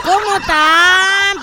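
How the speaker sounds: incredulous drawn-out question; exasperated. Real, not acted. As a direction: drag it out in disbelief. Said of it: "Agradece que no puedo decir malas palabras".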